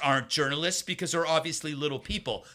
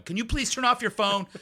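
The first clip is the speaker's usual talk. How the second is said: a raised, politely exasperated request; a hint of an irritated host hushing the room; mild mock scold; 8 semitones higher than usual. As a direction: ask them to shut their phone off, a touch annoyed. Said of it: "she's going".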